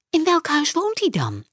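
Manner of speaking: put-on high girl's voice; young girl. A narrator's reading. Marjolein asks the old man, high curious girl's voice.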